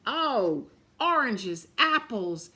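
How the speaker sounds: ordinary delivery, nothing marked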